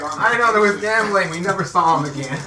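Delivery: high, loud, dramatic retelling; mock report. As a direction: play it like a rescuer's excuse. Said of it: "You guys are like".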